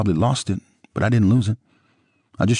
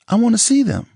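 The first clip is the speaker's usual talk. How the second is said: Nicole's voice; 6 dB louder than usual. A narrator's reading. Nicole pleading.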